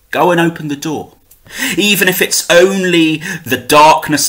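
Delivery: measured recital with the refrain lifted high; poetry reading. Acted. Poet reading his favourite translated poem to viewers, measured recital.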